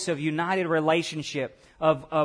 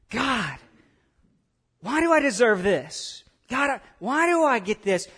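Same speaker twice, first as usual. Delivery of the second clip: higher-pitched, incredulous question; shocked and amazed; 5 semitones higher than usual. Acted. Sheep asking God about the reward, shocked and amazed. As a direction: ask in stunned amazement. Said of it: "they would just be shocked and amazed".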